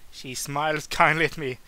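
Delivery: ordinary delivery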